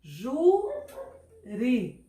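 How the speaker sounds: Júri split into JU, long pause, ri; stretched-out JU, then a detached ri in Júri; syllable by syllable. Shown as a demonstration. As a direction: dictate Júri slowly in two separate syllables, as if writing it. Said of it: "vamos escrever aqui".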